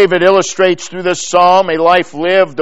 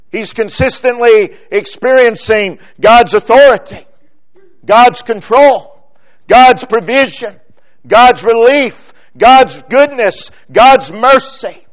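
building preacher's list, a little higher and louder; preaching; 4 semitones higher, 4 dB louder than usual. Acted. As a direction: press each phrase home from the pulpit.

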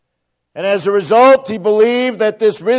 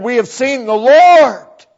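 proclaimed; 5 semitones higher, 6 dB louder than usual. Acted. Pastor quoting the disciples, proclaimed louder. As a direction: ring it out, fuller and louder. Disciples' words, contrasted by repetition.

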